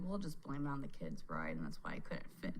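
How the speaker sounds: low, soft conspiratorial aside; low sheepish mutter. Retold or imitated. Quoting her excuse.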